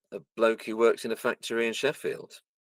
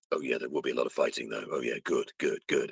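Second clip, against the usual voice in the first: deadpan game character; 7 semitones lower, 5 dB quieter than usual. Acted. Quotes his game character's deadpan line.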